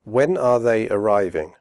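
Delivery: slow with full are; careful slow question; slowly. Shown as a demonstration. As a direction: say each word clearly, slowly. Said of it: "first slowly and then quickly".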